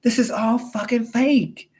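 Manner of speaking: the wife waking up to the fake world, alarmed; shocked realization. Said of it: "So she was like".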